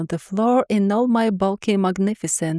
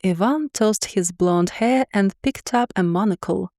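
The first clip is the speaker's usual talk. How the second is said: usual speaking voice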